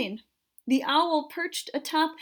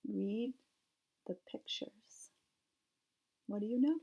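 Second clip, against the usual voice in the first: quiet aside; 2 semitones lower, 12 dB quieter than usual. Real, not acted. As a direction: drop to a soft aside. Teacher aside to child listeners.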